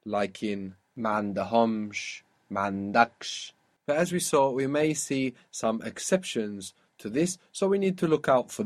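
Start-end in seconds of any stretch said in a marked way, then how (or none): 1.0-3.5 "mandahomsh, mandaksh" with HOMSH and DAKSH stressed; slowly weight each final syllable of "mandahomsh, mandaksh"; stress on last syllable. Shown as a demonstration. Teacher gives double consonant examples, stressing ends of mandahomsh, mandaksh. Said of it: "pull the accent onto the end".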